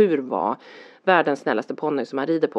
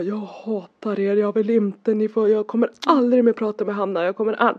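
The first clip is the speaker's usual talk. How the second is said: higher-pitched imitation of a hurt child; offended outburst; 5 semitones higher than usual. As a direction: mimic an offended kid refusing everything. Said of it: "då var han ju så stött".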